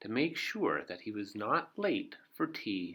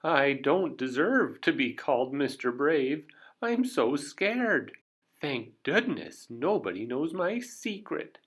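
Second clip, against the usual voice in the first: scared; 5 semitones higher, 5 dB louder than usual. Acted. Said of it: "shaking like a leaf, and scared".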